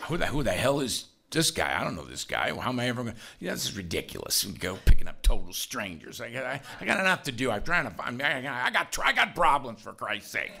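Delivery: low, muttered griping; grumbling to himself. Acted. Man griping alone on the way to his car, grumbling. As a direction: grumble under your breath about an unwanted errand. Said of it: "just me grumbling to myself".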